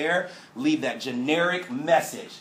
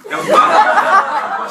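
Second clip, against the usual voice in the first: laughing; 15 dB louder, much slower than usual. Real, not acted. Cracking up mid-story.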